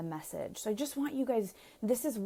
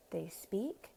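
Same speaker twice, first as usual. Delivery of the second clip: normal talking voice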